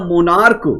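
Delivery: "monarch" with a final k sound; correct pronunciation. Shown as a demonstration. Teacher correcting the misreading for students, hard-k "monarch". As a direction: end "monarch" on a hard k, mo-NARK. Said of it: "it's not monarch".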